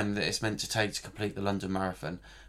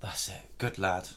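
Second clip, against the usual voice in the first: whispering; 4 semitones lower, 8 dB quieter than usual. Real, not acted. Praising partner after half marathon.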